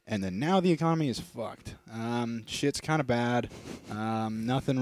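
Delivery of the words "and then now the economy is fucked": ordinary talk, nothing marked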